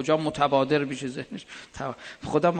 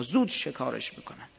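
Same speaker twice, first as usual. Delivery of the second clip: ordinary talk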